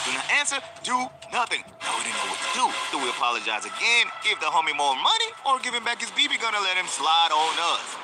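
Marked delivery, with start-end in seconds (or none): none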